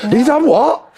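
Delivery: high, gasping gossip voice; mock Londoner gossip. Imitating Londoners passing on gossip, high and gasping. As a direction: ham up a nosy Londoner spreading rumours. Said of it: "That's what Londoners go".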